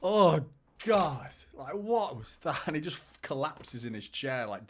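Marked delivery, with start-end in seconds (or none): 0.0-2.6 loud, high exasperated groan of oh, oh, God; mock despair at a player's bad decision; Pep Guardiola disgusted. Pep reacting to a player's mistake, loud disgusted outburst. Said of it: "he's just like".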